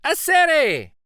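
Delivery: high, loud happy call of a friend's name; joyful shout. Acted. Elder spotting his friend on the bridge, joyful shout. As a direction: shout it out, thrilled to see him. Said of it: "he shouts".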